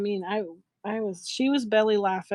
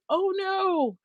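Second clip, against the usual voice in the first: very high, drawn-out mock wail; retold dismay; 11 semitones higher than usual. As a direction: replay your dismay way up high. Repeats her dismayed reaction.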